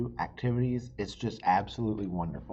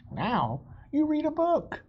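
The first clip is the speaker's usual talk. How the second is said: higher, louder sing-song librarian; cheery librarian; 6 dB louder than usual. Acted. Librarian telling Pete to read, high cheery voice. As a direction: announce it brightly to a child. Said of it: "the librarian says".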